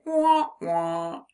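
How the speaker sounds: mock disappointed "wah, wah"; a teacher playfully mourning the missing G; sad trombone wah-wah.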